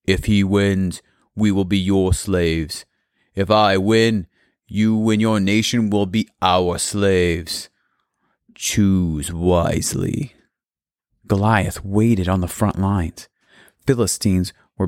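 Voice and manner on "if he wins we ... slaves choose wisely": booming voice. Acted. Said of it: "his voice boomed across the valley".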